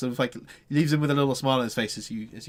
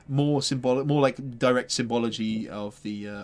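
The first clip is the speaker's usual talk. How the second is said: normal talking voice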